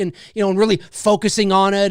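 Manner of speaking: normal talking voice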